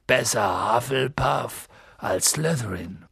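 slow, dark warning voice; gloomy giant. A narrator's reading. Giant warning Harry about the school houses, gloomy. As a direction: drag it out gloomily. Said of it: "Hagrid mit düsterer Stimme".

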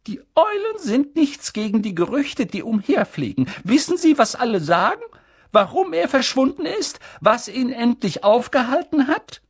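high, urgent voice of an agitated stern professor; stern witch teacher. A narrator's reading. Professor McGonagall pressing Dumbledore for news, high and urgent. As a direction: press for gossip like an anxious headmistress.

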